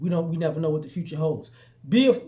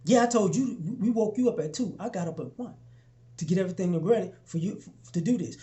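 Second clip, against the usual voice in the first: calm fraternity leader; 6 dB quieter than usual. Retold or imitated. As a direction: quietly put the complainer in his place. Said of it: "he says".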